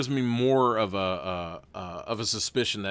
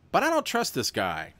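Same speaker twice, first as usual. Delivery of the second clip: normal talking voice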